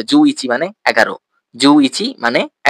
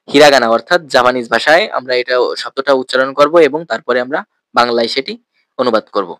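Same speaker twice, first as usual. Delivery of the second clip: ordinary delivery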